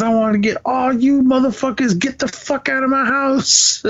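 high-pitched mock yelling, no real volume; retold angry yell. Retelling a dad ordering everyone out of his house, mock yelling. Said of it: "they were just screaming and yelling".

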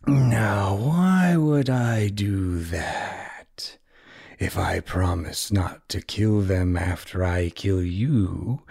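slow, whispered villain threat; menacing villain. Acted. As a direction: purr the threat slowly, coldly. Villain's reply read aloud.

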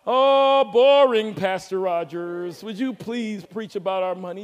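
loud drawn-out groan sinking into low, slow complaint; hammed-up whiny churchgoer, starting loud and dragging the words; mock bored congregant. Congregant begging the pastor to preach on money, mock bored.

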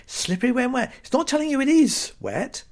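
high-pitched querying repeat; mock incredulous. Comedian scoffing at a redundant sign, mock incredulous.

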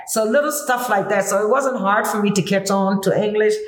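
ordinary delivery

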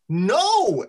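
high, loud, sharp refusal; snapped. A narrator's reading. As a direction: snap it out, pitch jumping up. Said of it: "Eldar snapped".

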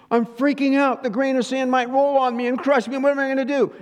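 loud, high-pitched freak-out; mock panic. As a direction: play someone panicking over something tiny. Acting out panic over sand grain.

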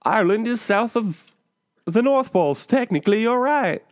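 high, cheery stage Irish voice; Irish accent. Pretend. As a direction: keep the hammy Irish lilt going. Keeps joke Irish voice going.

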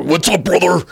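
gruff wrestler shouting brother; Hulk Hogan impression. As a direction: hype it like the Hulkster. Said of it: "I don't do Hulk".

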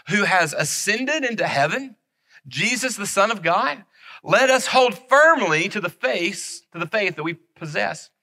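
measured, solemn Bible reading; scripture reading. Acted. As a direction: read the verse as holy text. Reads Hebrews aloud.

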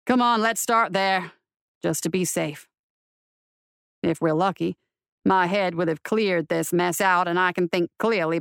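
low, dry, weary marshal. A narrator's reading. Marshal has had enough surprises today, weary. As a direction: talk low and tiredly to a horse.

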